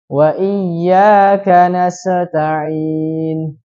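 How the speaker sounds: nasta'in with a bouncing qalqalah on the sin; qalqalah on sin mistake. Shown as a demonstration. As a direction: recite slowly, bounce the sin. (sin pada nasta'in dibaca memantul qalqalah)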